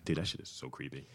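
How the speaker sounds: hushed creeped-out whisper. Real, not acted. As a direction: whisper it, spooked. Creeped out by knitted son.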